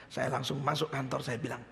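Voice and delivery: ordinary talk, nothing marked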